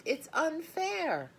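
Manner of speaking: high, slow pleading; girl pleads with father; sobbing. A narrator's reading. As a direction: stretch out the protest tearfully. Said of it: "she sobbed".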